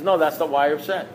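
even, unruffled correction; imitating an unbudging, calm sponsor. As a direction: answer patiently in an ordinary pitch. Sponsor calmly repeats his verdict.

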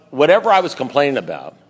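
normal talking voice

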